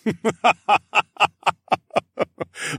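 long, booming, hearty laugh that rolls on; long loud laugh. Acted. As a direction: throw your head back and laugh long and loud. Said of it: "laughed, long and loud".

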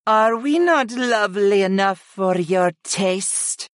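high-pitched, wounded goddess; sniffy. A narrator's reading. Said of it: "Utuni sniffed".

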